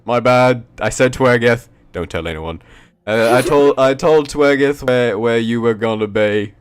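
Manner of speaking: high, loud goofy guard; dopey guard voice. Acted. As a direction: blurt it out like a clumsy guard.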